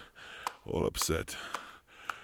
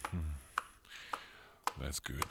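ordinary talk

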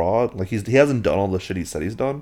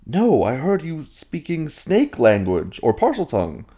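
incredulous friend; 8 semitones higher than usual. Retold or imitated. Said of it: "friend answers".

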